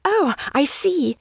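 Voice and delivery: high, drawn-out excited realisation; excited cry. A narrator's reading. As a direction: cry out in delight at finally understanding. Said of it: "Once she cried excitedly".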